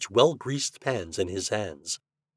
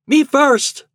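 very high, loud yelp; eager cry; 10 dB louder than usual. A narrator's reading. Herbie wants first try at the apples, crying out. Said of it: "cried Herbie".

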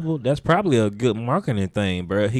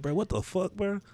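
high, quiet baffled question; half-asleep disbelief; confused; 9 semitones higher, 7 dB quieter than usual. Retold or imitated. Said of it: "I was like".

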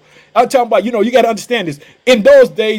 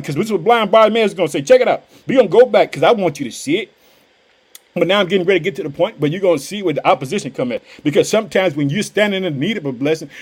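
normal talking voice

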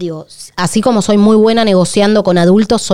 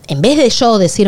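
ordinary talk, nothing marked

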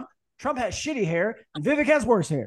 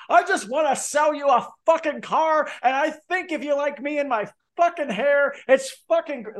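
mocking Vivek; 8 semitones higher than usual. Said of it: "this Vivek guy's like".

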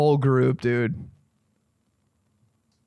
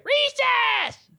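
put-on very high, stretched-out kid squeal; mock schoolkid shriek. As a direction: squeal like a kid let loose. Imitates kids rushing out to recess.

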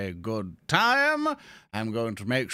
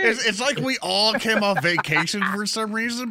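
excited; 11 semitones higher, 6 dB louder than usual. Real, not acted. Laughing excitedly.

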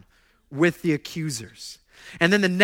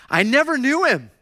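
denial shout; 9 semitones higher, 7 dB louder than usual. Retold or imitated. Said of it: "He deny".

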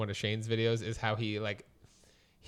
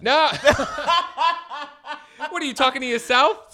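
laughing; 10 dB louder than usual. Real, not acted. Laughing after partner's joke.

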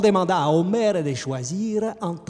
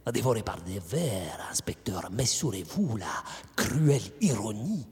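murmuré, sans voix, plein de sous-entendus; chuchoté; 5 semitones lower, 5 dB quieter than usual. Acted. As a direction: souffler la cruelle ironie à l'oreille de l'inspecteur. Répète la phrase en chuchotant. (chuchotement sinistre au policier)

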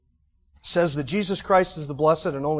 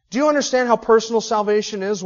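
loud, high-pitched sermon build, slowing on key words; preaching at full force; 7 semitones higher, 9 dB louder than usual. Acted. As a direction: preach it with rising fire.

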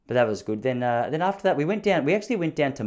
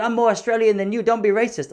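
high, loud, mock-offended old man; indignant stallholder; 8 semitones higher, 4 dB louder than usual. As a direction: puff up like an old man who's been insulted. Stallholder talking back to him.

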